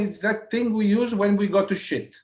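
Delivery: laughing guide explains the name to him; Gora Devi. Retold or imitated. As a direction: tell it like a cheeky older woman. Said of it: "Gora Devi?".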